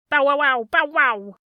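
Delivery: loud yapping bow wow; dog barking. A narrator's reading. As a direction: bark it out like a guard dog. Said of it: "barked as if he were a real watchdog".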